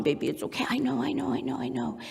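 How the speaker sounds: hushed, murmured comfort; attuned soothing. Acted. Mom attuned to crying baby, gently hushing. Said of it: "when I am attuned".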